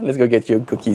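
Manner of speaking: ordinary talk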